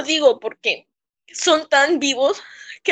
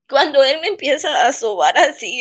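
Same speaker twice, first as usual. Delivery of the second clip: ordinary delivery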